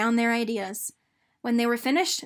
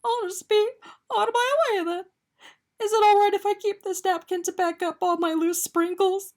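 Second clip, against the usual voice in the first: holding back tears; 9 semitones higher, 5 dB louder than usual. Acted. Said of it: "fighting back tears".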